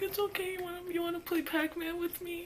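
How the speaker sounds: put-on teen girl asking the crying kid what happened; concerned teen girl. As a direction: fuss over the crying kid like a big sister. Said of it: "the girl was like".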